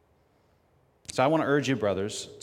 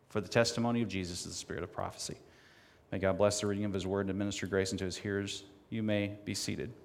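ordinary talk, nothing marked

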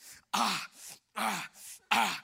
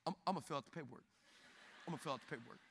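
much softer and lower, hurried sheepish mumble; meek surrender; 9 semitones lower, 15 dB quieter, much faster than usual. Retold or imitated. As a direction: back down like a scolded kid. Meekly giving in to receptionist.

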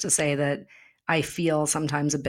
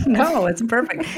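higher, louder laughing no; 8 semitones higher, 6 dB louder than usual. Real, not acted. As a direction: laugh it out, reassuring. Laughing reply to "too much.

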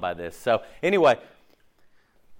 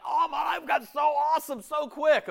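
high-pitched, gushing new believer; hammed-up amazed delight; mock excitement. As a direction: gush it in a much higher voice.

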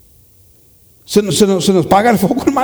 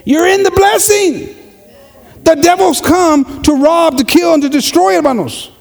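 full-force preaching; 9 semitones higher than usual. Real, not acted. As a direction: preach it out to the congregation.

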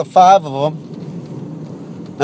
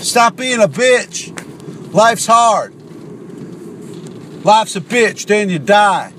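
higher-pitched mock telling-off, stretched words; acted tough-love scolding; 4 semitones higher, slower than usual. Imagined real-man friend telling Howard to shut up, mock-angry scolding. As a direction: play the real-man friend berating a whiner. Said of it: "tell me to shut up".

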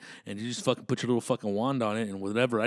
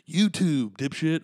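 loud retort; 5 semitones higher, 5 dB louder, slower than usual. Real, not acted. Friend corrects him with a loud jab. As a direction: punch it out, louder and higher.

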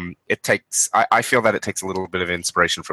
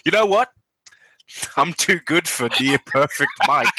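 mock snooty boast; 5 semitones higher than usual. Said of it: "and you were like".

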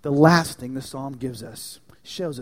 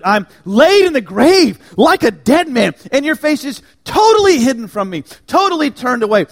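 higher and louder, crying out in despair; anguished cry; 9 semitones higher, 15 dB louder than usual. Acted. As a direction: cry it out like a forsaken man. Voicing the psalmist's despair.

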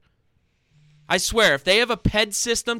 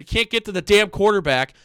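usual speaking voice

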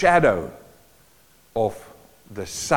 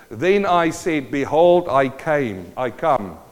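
raised, declaiming scripture; scripture reading; 4 semitones higher than usual. Acted. Preacher reading Hebrews aloud to the church, declaiming. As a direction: proclaim the verse to the congregation.

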